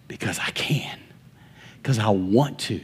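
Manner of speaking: near-whisper rising to soft, low voice; hushed. Real, not acted. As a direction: confide it quietly to the congregation. Hushed answer to own question.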